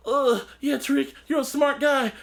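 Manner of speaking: high-pitched mock pleading; imitated wounded pleading. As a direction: lightly act a hurt man placating his captor. Voicing wounded man pleading with Tariq.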